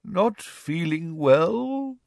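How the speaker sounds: put-on very slow, high, bewildered old professor; dreamy, drawn-out puzzled question; hazy. A narrator's reading. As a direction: echo it vaguely, stretched right out. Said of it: "he repeated hazily".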